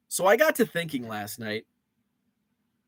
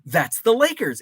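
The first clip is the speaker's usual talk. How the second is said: lightly voiced wife, higher and a bit louder; proud wife; 7 semitones higher, 4 dB louder than usual. Husband imitating his proud wife answering Jeopardy. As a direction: play the wife proudly naming the team. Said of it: "and she's like".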